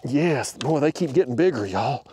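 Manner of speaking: ordinary talk, nothing marked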